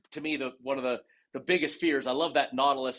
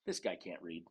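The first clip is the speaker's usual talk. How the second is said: low, much softer muttered aside; doubtful aside; 11 semitones lower, 12 dB quieter than usual. Retold or imitated. Instructor's wife doubting the new student, low muttered aside. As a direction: mutter it under your breath, imitating someone.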